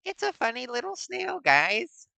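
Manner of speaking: very high squeaky cooing voice; cutesy voice. Pretend. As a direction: coo over it like a baby animal. Cooing over snail car.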